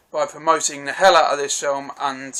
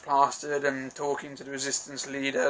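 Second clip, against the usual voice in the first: usual speaking voice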